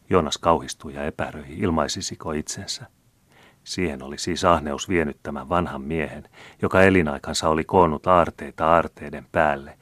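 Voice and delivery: ordinary delivery, nothing marked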